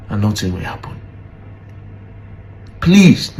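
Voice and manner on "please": a sudden high, loud please. Real, not acted. Begging the Nigerian police to answer, loud plea. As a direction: cry out the please.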